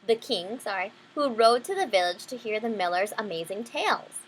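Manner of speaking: ordinary delivery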